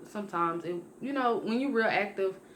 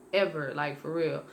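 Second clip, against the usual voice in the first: ordinary delivery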